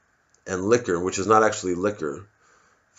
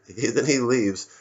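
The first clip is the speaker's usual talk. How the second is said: usual speaking voice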